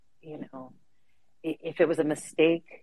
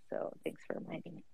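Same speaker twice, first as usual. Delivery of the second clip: whispering; 19 dB quieter, faster than usual. Real, not acted. Aside after drinking remark.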